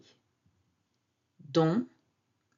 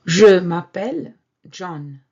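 "Je m'appelle John" said much louder; loud model line; 12 dB louder than usual. Shown as a demonstration. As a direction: announce "Je m'appelle John" loudly. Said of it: "they would mention their name".

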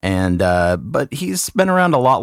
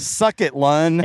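higher-pitched mock taunt; playful gloating; 7 semitones higher, slower than usual. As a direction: rub it in, teasing a friend. Gloating over having a name suffix.

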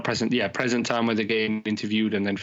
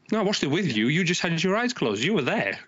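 higher-pitched indignant self-defence; mock defensive; 7 semitones higher than usual. Husband answering wife's guilt trip, mock defensive. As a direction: act out a mild protest of innocence. Acted argument with wife over episode.

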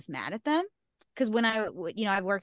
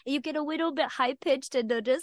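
put-on baby voice; 5 semitones higher, 5 dB louder than usual. Shown as a demonstration. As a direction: coo as if about a kitten. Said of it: "it's, like, kind of like a baby".

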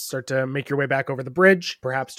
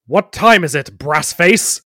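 booming, mocking call; loud taunt; 3 semitones higher, 10 dB louder, slower than usual. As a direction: shout the taunt at the little goblin. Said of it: "the man with the tentacle ... says".

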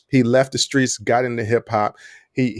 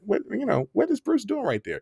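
higher, softer puzzled voice; mock puzzled viewer; 9 semitones higher, 6 dB quieter than usual. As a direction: act out a confused viewer, a little hushed. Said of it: "you'll see me in certain sections be like".

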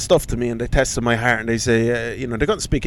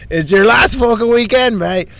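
loud, higher laddish cheer; rowdy lad; 9 semitones higher, 6 dB louder than usual. Retold or imitated. To the groom at the stag do, a rowdy laddish cheer. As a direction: bellow it like a drunk stag-do lad.

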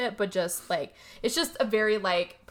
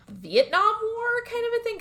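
high, incredulous question; mock disbelief; 13 semitones higher, 5 dB louder than usual. Mary asking Rose which war, high and incredulous. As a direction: pitch it up in disbelief.

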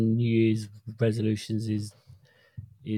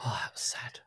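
whispered; 13 dB quieter, faster than usual. Real, not acted. Mock pity after failed bump.

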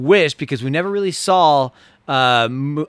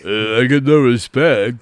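slower mock comedian voice on I get no respect; slow comedian catchphrase; 4 semitones lower, slower than usual. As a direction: drawl it out like a tired stand-up. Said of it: "it's a tough mix".

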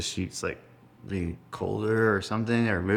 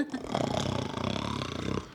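snore like a sleeping rhino; imitated rhino snore; 6 semitones lower, 5 dB quieter than usual. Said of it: "My grandfather snores like a rhino".

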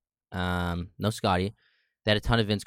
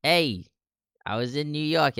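put-on Brooklyn accent; 7 semitones higher than usual. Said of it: "Is that your Brooklyn accent?".

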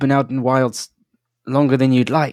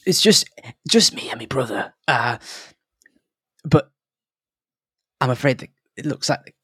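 a lightly voiced nervous halfling, higher; flustered halfling; 3 semitones higher than usual. Halfling prospector apologising to the travellers, lightly voiced and flustered. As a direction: babble apologies in a fretful little voice.

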